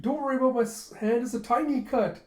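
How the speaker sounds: high, loud insistent reassurance; urgent protest. Retold or imitated. Girl protests about her cut as he runs off, urgent. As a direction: call it out, pitched well up.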